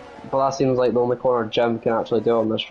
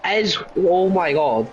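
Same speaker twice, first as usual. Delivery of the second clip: rising, excited race call; motorsport commentator; 7 semitones higher, slower than usual. Real, not acted. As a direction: call the overtake live. Live race play-by-play.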